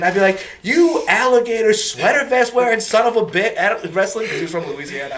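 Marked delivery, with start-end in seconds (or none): 0.6-4.3 higher-pitched mock yelling insult; hammed-up classroom screaming match. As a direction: hurl a playful tirade at a classmate. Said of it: "like, scream at each other".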